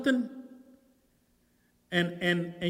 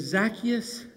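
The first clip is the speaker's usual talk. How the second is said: slow, dropping softer word by word; spaced, hushed but firm; quiet command; 4 semitones lower, 4 dB quieter, slower than usual. Acted. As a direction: lower and softer, each word apart. Jesus commands him down.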